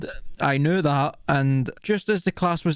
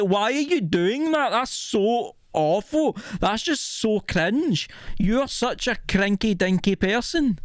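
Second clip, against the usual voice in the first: higher, drawn-out exasperated whine; retold annoyance; 5 semitones higher, slower than usual. Pupil to homework snitch after class, exasperated and annoyed. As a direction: act out cringing annoyance, stretching the words slowly. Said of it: "I was annoyed. I'm like".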